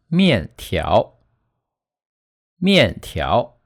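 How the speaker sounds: 面条 with 面 falling and 条 rising from low; fourth plus rising tone. Shown as a demonstration. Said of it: "start from a lower pitch and end".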